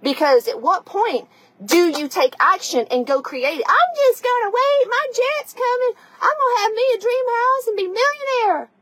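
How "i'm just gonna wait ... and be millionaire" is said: high, far-off mocking voice of a daydreamer; airy high mock daydream; mock dreamy wisher. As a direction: lampoon someone waiting for a jet. Mocking dreamy women who wait.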